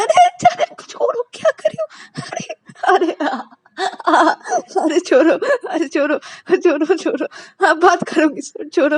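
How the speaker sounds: very high, loud laughing pleas; being tickled. Acted. Payal begging Ananya to stop tickling, laughing. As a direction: giggle and beg to be let go. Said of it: "पायल बोलता".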